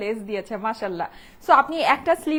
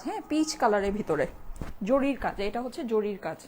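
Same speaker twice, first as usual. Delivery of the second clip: hushed, softer voice during the call to prayer; speaking softly; 10 dB quieter than usual. Real, not acted. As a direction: keep your voice down out of respect. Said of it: "আমি একটু আস্তে কথা বলি". (আজানের সময় নিচু গলায় আস্তে কথা বলা)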